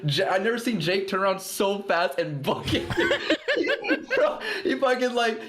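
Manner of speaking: high, breaking into real laughter; laughing through the story. Real, not acted. Friend laughing while telling how Jake spun around, real laughter.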